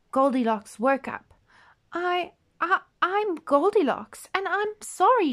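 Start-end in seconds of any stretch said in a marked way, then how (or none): 1.9-5.3 put-on high, stammering little girl voice; frightened little girl. Goldilocks apologises to the bears, high and frightened. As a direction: stammer it out, scared and small. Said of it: "she cried".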